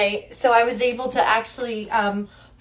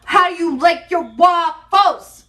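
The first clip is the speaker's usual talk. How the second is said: loud, high quizzing shout; playful shout; 5 semitones higher, 4 dB louder than usual. Pretend. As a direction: call it out like a game host. Shouting the quiz.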